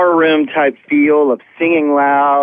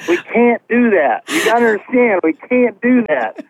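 retold protest; 6 semitones higher than usual. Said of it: "and I was like".

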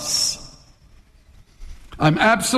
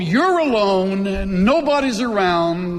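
ordinary delivery

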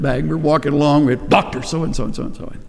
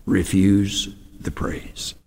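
very slow and softer on "Refuse the praise"; slow, hushed repetition; 5 semitones lower, 6 dB quieter, much slower than usual. Real, not acted. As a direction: space out "Refuse" and "the praise", quietly. Point repeated, slower and softer.

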